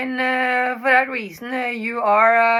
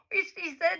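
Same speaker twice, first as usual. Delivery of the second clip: ordinary delivery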